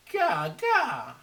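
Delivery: put-on high, loud cawing baby cry; baby raven cry. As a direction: squawk it like a demanding baby bird. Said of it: "he cried".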